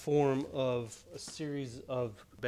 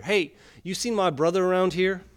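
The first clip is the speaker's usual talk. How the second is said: duck calling out; 6 semitones higher, 10 dB louder than usual. Acted. Duck asks bartender loudly.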